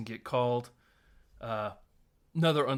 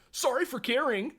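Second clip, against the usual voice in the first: mock offended; 12 semitones higher, 4 dB louder than usual. Retold hurt retort.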